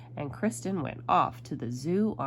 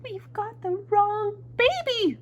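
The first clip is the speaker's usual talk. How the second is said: high, slow, loud dismay; drawn-out high exclamation of shock; alarmed mother; 6 dB louder, slower than usual. A narrator's reading. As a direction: wail it out, stretching the last words.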